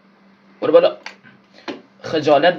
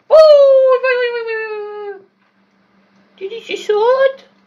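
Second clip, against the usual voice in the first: shocked cries; 8 dB louder than usual. Real, not acted. Gamer wails high when his character falls. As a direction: cry out in disbelief.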